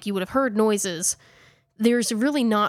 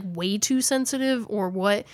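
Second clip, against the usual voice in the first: normal talking voice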